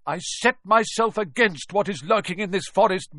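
loud, high, defiant shouting; angry bellowing. Acted. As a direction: stand your ground and roar. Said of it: "Firenze bellowed at Bane".

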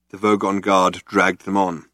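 ordinary delivery, nothing marked

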